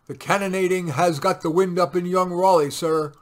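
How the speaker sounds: loud urgent report. A narrator's reading. Sergeant reporting to captain over the guns, loud. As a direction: call it over the cannon fire.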